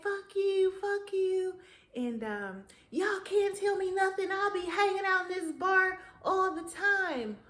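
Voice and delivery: put-on high, loud country drunk voice; country hillbilly impersonation. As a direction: ham up a rowdy country drunk mouthing off. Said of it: "my impersonations of Caucasian folks so country".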